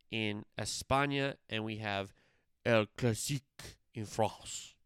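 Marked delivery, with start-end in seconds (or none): 2.6-4.7 put-on slow playful French accent, trying to sound French; hammed-up French accent. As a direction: roll the French name in a French accent. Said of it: "I don't do a bad accent".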